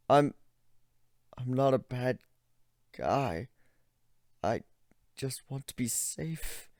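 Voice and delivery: whimpering. Acted. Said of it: "he whimpered pathetically".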